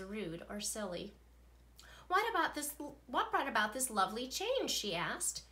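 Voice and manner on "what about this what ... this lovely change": pleased surprise. Acted. Teacher delighted by change.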